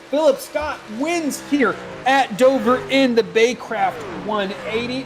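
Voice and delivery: loud, slow, punched-out finish-line call; excited winning call. Real, not acted. Race commentator announcing the winner at Dover, excited. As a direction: ring out each word for the winner.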